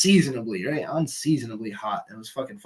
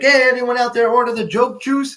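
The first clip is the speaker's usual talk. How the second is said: raised, higher call to the room; retold call out to the crowd; 10 semitones higher, 7 dB louder than usual. Comedian calling out to the audience, loud and high. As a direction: hail the bar crowd from the stage. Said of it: "he calls out".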